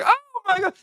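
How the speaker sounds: high, loud fake-shocked "Oh my God"; mock shocked gasp. Mocking everyone who acts surprised at the news, high and loud. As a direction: parody a shocked person overacting surprise. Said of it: "Everyone's like".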